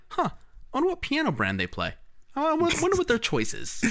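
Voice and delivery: high, light musing voice; imitating own curiosity. Mocking own piano obsession while watching music videos, high curious musing. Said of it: "I'm like".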